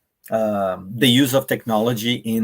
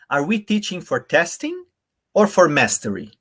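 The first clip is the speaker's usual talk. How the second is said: "we" पर ऊँचा चढ़ता ज़ोर; stress on WE; 4 semitones higher than usual. Shown as a demonstration. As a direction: push the word we up high. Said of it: "So if I read it like this".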